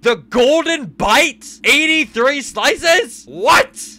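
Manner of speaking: slow, stunned repeat; can't believe the menu; incredulous. Real, not acted. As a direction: drag it out in disbelief.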